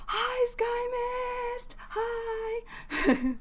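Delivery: high, drawn-out squeal of hello; delighted high-pitched hi; excited greeting. Real, not acted. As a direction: greet an arriving friend in a high, stretched-out singsong. Greeting a viewer who joins chat.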